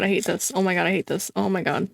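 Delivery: ordinary talk, nothing marked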